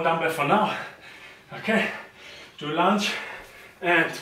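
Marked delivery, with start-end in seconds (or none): none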